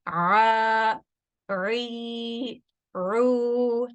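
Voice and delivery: a, ri, ru stretched with throat constriction; teacher drilling long vowels with ain. Shown as a demonstration. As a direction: hold the long a, ri, ru. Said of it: "we have the med letters".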